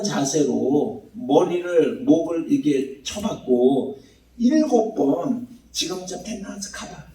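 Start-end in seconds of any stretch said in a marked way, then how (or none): 5.7-7.0 very soft muttered check; hushed acted aside. Elijah at prayer sends his servant to look, hushed murmur. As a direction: drop to a near-silent murmur mid-prayer.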